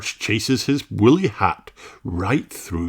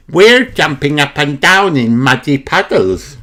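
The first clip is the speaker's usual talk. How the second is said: put-on lightly higher little pig girl; Peppa voice; 5 semitones higher, 7 dB louder than usual. A narrator's reading. Peppa showing off jumping in puddles, light girl voice. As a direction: Peppa shows baby Alexander the puddles. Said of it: "says Peppa".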